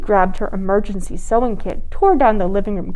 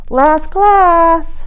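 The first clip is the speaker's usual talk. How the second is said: high, louder call; firm call; 8 semitones higher, 7 dB louder than usual. A narrator's reading. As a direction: insist from the doorway. Mother from kitchen.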